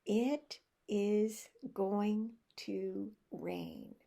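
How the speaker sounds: very slow, word by word; finger technique. Shown as a demonstration. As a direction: separate each word with a small gap. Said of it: "I use the finger technique".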